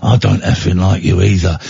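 low tough-guy voice out the side of the mouth; Jason Statham impression. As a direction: mutter it like a hard man. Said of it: "Statham talks out the side of his mouth like that".

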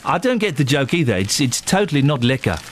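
put-on playful South African accent. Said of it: "I'm doing a South African".

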